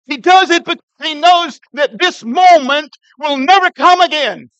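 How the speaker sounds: loud, high preaching at full force; fiery preaching. Real, not acted. Preacher urging the church to act now, full-force preaching. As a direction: drive the point home to the congregation.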